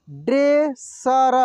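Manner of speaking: Dresser drawn out slowly, syllable by syllable; slow sounding out. Shown as a demonstration. Teacher slowly sounds out dresser for students. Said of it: "एक एक मात्रा को अलग अलग करके".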